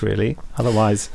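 ordinary delivery

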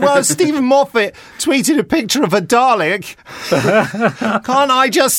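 very high, loud mock-childish pleading; squabbling schoolchild. Host mimicking his own protest about being gagged, high childish squabble. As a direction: argue back like an indignant kid. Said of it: "two school children having a fight".

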